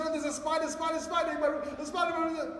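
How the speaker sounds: high-pitched mock panic; frightened child blurting it out, imitated; crying daughter. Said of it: "my daughter came crying".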